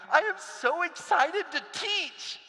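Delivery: high, slow, bubbling with excitement; eager new teacher. Acted. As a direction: gush like a brand-new teacher on day one.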